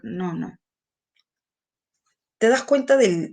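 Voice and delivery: ordinary talk, nothing marked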